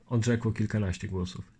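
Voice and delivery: plain reading voice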